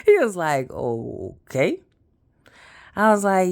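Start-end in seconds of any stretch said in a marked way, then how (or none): 0.7-1.8 low, drawn-out okay, rising at the end; brother's deep voice. Retold or imitated. Brother after hearing pregnancy news, imitated deep drawn-out reply. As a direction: stretch it out deep, like a diplomatic brother. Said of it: "That's how he sound".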